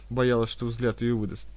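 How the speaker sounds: ordinary delivery, nothing marked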